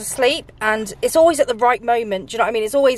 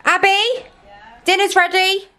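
higher, raised calling voice; 5 semitones higher than usual. Real, not acted. Calling someone in to dinner, raised voice. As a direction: call to another room.

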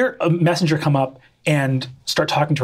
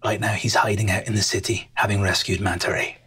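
soft, low, partly whispered; hushed reading; 6 semitones lower, 3 dB quieter than usual. Acted. Captain quietly reads the letter to the stowaways. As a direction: read it in a hushed secret voice.

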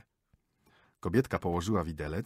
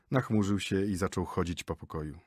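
ordinary delivery

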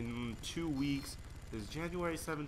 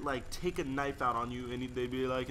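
ordinary talk

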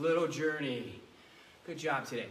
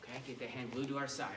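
usual speaking voice

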